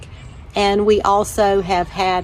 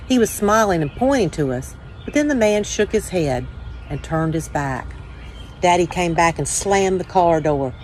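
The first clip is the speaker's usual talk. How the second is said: put-on calm, steady read-aloud voice for children; story reading. A narrator's reading. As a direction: tell it gently to young listeners, like at story time. Reads children's picture book aloud.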